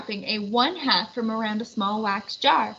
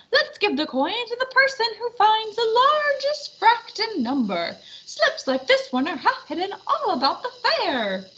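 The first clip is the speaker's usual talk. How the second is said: high squeaky little puppet; small eager puppet, much higher; tiny puppet voice; 9 semitones higher than usual. Acted. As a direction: play the diminutive Half Pint. Half Pint puppet announces contest.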